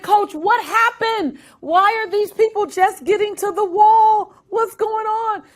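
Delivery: high, puzzled asking; confused and amazed; bewildered. Retold or imitated. Young swimmer asking coach what happened, high bewildered. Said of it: "I said".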